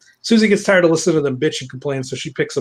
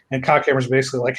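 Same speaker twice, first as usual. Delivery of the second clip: ordinary delivery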